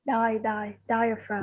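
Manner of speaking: diaphragm as DIE-uh-fram, syllable by syllable; model pronunciation. Shown as a demonstration. Teacher breaks diaphragm into syllables for her student. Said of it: "Từ này".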